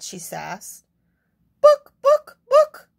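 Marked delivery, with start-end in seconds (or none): 1.6-2.9 very high, loud clucks; clucking hen. Acted. Hen clucking clearly at the librarian. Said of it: "clucked the hen quite clearly".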